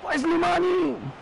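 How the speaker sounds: very high, shrieking gol gol; ecstatic goal scream. Real, not acted. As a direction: lose it over the goal. Goal scored, shrieking oddio and gol.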